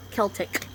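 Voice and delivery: "Celtic" said softly with a hard K; hard K pronunciation. Shown as a demonstration. Vlogger tries the doubted pronunciation, hard-K "Celtic". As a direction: begin "Celtic" like kick. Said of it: "I don't think it sounds right going".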